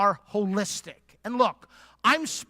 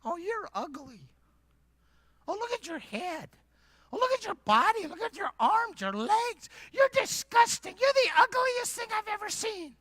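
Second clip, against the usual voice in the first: high squeaky voice full of disgust; disgusted clay figure; 10 semitones higher than usual. Pretend. Clay figure in front of the mirror, squeaky and disgusted. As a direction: sneer at yourself in a cartoon squeak.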